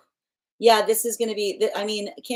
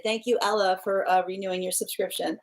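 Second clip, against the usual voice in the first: ordinary talk, nothing marked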